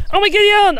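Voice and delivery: startled, delighted outburst; surprised exclamation. Real, not acted. Gardener surprised by his dog turning up, high exclamation. Dog Pandora suddenly arrives.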